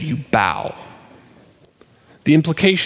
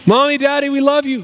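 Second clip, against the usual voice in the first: put-on high child's call to the parents; high, singsong bedtime wail; 12 semitones higher, 3 dB louder than usual. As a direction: imitate a little girl calling out from bed. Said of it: "we'll hear wailing across the span".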